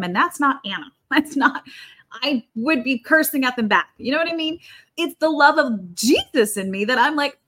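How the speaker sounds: ordinary talk, nothing marked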